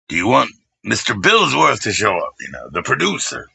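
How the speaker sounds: normal talking voice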